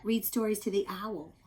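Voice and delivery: ordinary delivery, nothing marked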